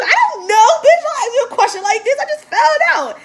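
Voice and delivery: high, loud, flustered; answering her friend in a frantic high voice; panicked. Retold or imitated. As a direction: blurt it out in a panic.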